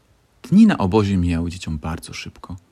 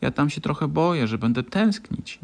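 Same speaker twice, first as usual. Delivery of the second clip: shy; 7 semitones higher than usual. A narrator's reading. Lisiczka admits to friends she'll miss home, timidly. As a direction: say it shyly, half afraid. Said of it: "nieśmiało rzuciła Lisiczka".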